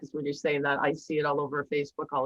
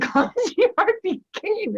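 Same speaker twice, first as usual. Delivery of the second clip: high, slow words through a laugh; 5 dB louder, much slower than usual. Real, not acted. Telling the host about dropping dishes, laughing. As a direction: laugh it out at yourself. Laughing at her own clumsiness.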